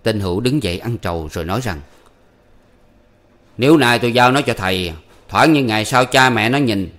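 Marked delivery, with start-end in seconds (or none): 3.6-6.9 louder, higher questioning man; father haggling over selling his son, pointed; father Hữu. A narrator's reading. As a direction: press the old man about the money. Father bargains with old man.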